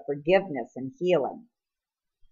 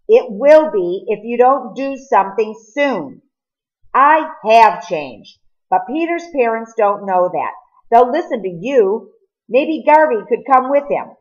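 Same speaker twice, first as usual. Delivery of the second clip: raised, pressing voice to make him listen; urgent pleading; 5 semitones higher, 6 dB louder than usual. Acted. Cole urging Edwin to bring Peter, raised and pleading. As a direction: push hard, louder and higher, begging him to act.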